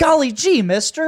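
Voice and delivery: put-on high, loud golly-gee kid voice; wholesome 1950s kid. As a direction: chirp it like a fifties paperboy. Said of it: "like, generic fifties, sixties".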